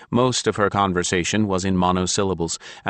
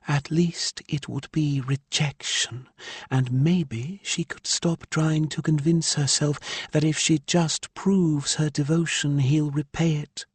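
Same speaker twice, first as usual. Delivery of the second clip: higher woman's voice, aggrieved; Ilya's voice; 9 semitones higher than usual. A narrator's reading. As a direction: voice the exasperated mother Ilya. Narrator voices Ilya.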